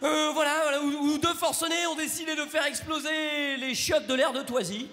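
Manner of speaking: high, shouted on-the-scene reporter; news reporter voice. Retold or imitated. Comic imitating a frantic reporter at the scene, shouting. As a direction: play a frantic TV reporter at the attack site. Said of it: "Avec le journaliste".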